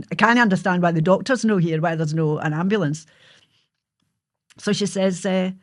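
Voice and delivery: normal talking voice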